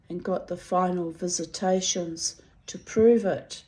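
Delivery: ordinary talk, nothing marked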